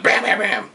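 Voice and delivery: high, rapid spoken gunshots; mouth-made gun bursts; imitated gunfire. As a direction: fire off the shots with your voice.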